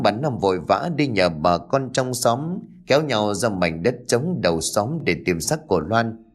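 ordinary delivery, nothing marked

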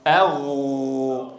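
أغ stretched very slow and low; heavy ghain. Shown as a demonstration. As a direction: draw out غ full and heavy. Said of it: "ولا تقل أغ".

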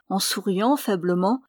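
ordinary delivery, nothing marked

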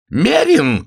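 high, loud feminine shriek; shrieked abuse; angry yell. A narrator's reading. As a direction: hurl the insult after someone leaving. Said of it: "крикнула она ему вслед".

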